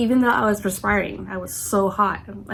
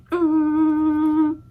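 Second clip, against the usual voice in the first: long, high, steady hum imitating a headache; nonstop drone; 7 semitones higher, 4 dB louder than usual. Showing how the headache feels, nonstop droning hum. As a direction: hold an unbroken "mm", pitched up. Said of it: "is just nonstop. It's just like".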